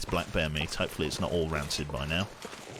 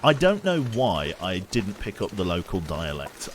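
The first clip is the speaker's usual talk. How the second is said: normal talking voice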